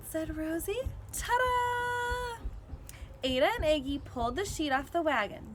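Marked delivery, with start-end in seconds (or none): 1.1-2.4 high showy ta-da; proud reveal. A narrator's reading. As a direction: present it with a flourish. Rosie's big reveal.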